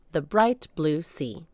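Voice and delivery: plain reading voice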